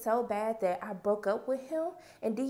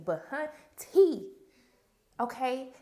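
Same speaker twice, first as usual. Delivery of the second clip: drawn-out sass; much slower than usual. Real, not acted. Emphatic aside to viewers.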